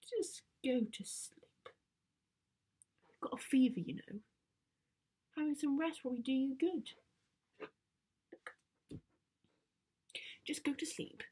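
put-on persuasive, mildly pleading teen; coaxing teenage daughter. Teen coaxing feverish Dad to sleep, persuasive. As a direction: gently talk him into resting.

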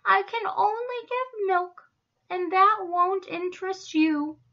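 high, plaintive storybook cow; self-pitying cow. A narrator's reading. Miss Moo doubts the zebras care, plaintive. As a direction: lament your dullness in a high voice. Dejected cow explains herself.

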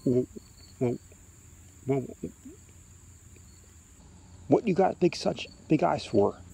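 a touch of fear, faltering on the first words; timid boy working up to ask; mildly frightened boy. A narrator's reading. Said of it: "so frightened he can't move".

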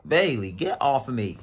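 loud telling-off; scolding the dog. Real, not acted. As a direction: order the pet off sharply.

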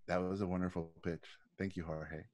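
low, soft, emotionless reply; a hint of an unmoved studio boss, flat as stone; lightly voiced executive giving nothing away; deadpan [name]. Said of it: "zero emotion".

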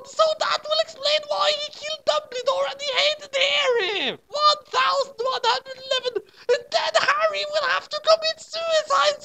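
very high, squeaky, gushing fan; breathless high-pitched fangirl. Acted. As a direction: gush like an excited teen author. Author's note read aloud.

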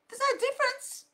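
high insistent repeat; emphatic high repeat. Shown as a demonstration.

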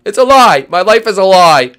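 high, loud hammed-up wail; mock despairing yell. Player complaining about life, hammed-up yelling.

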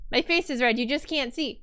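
ordinary delivery

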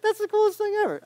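mock-giddy gush; giddy delight. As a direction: squeal like a thrilled kid. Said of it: "And I was like".

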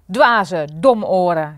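loud, scornful shout; shouting insults. A narrator's reading. Furious king shouting insults at his lackeys. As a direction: yell the insults at them. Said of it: "schreeuwde koning Gulzig".

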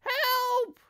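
piercing high cry for help; screamed. A narrator's reading. Wife terrified of the skillywigger, screaming for help. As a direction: shriek in terror. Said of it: "screamed Missus Twit".

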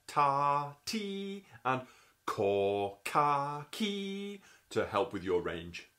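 ta rising to ti, then ko ka ki, each syllable spoken apart; slow syllable demo climbing in pitch; tonguing vowel demo. Shown as a demonstration. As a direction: step ta ti up in pitch, then ko ka ki slowly. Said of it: "you can think about saying to".

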